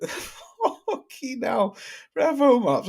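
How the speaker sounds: high, drawn-out words through a laugh; laughing disbelief. Real, not acted. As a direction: still cracking up, slow and high. Still laughing at the joke.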